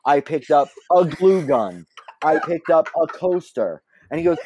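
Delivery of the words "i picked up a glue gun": plain reading voice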